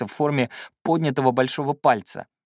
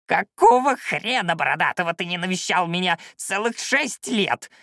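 put-on high-pitched, indignant old shaman; old man's roar; 7 semitones higher than usual. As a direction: scold in a raised, creaky voice. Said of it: "снова взревел старик".

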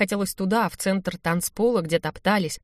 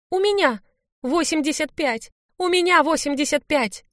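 put-on very high, girlish squeal; thrilled high-pitched outburst; excited squeal; 8 semitones higher than usual. A narrator's reading. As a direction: claim the win in a high squeal. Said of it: "взвизгнула Аня и вскочила".

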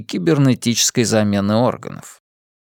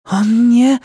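plain reading voice

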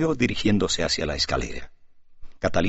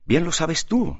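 blurted out; 9 semitones higher, slower than usual. A narrator's reading. Alejo can no longer contain himself, blurting out. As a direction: burst out higher, unable to hold back. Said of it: "dejó escapar Alejo sin poderse contener".

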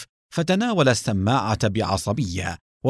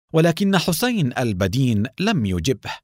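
ordinary delivery

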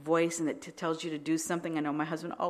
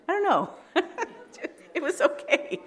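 high, loud laughing aside; 13 semitones higher, 5 dB louder than usual. Real, not acted. Laughing as she tells the church about their parents' days. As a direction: laugh it off, much higher and louder.

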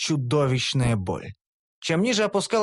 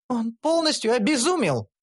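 higher, alarmed elf cry; elf exclaiming; 9 semitones higher than usual. A narrator's reading. Elf shocked at the raving hero, alarmed exclamation. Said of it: "воскликнула Карамелька".

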